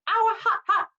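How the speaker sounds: high, loud yelps; pained yelling. A narrator's reading. Grover holding the burning whip, high pained yelps. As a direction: yelp as if your hands are burning. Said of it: "he yelled".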